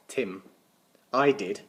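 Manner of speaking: ordinary delivery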